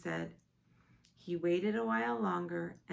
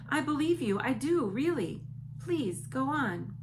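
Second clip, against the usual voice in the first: loud, higher eager plea; crying out; 8 semitones higher, 8 dB louder than usual. A narrator's reading. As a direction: Sophie begging him. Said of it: "Sophie cried".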